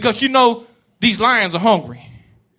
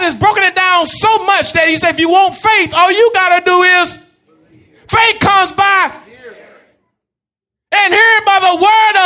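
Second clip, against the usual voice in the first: raised, pressing preacher cadence with shouted peaks; full-force preaching; 8 semitones higher than usual. Acted. Preacher exhorting the church, full-force preaching. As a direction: preach it hard to the congregation.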